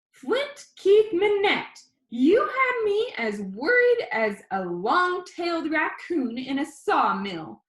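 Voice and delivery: high, slow, scolding grandma voice; grandma voice pitched high and drawn out; worried grandma. A narrator's reading. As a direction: voice Grandma fretting and relieved. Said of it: "Grandma said".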